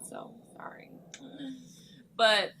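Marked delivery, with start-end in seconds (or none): 0.1-0.9 breathy whisper, an aside; whispered apology. Real, not acted. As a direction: whisper it as a sheepish sorry.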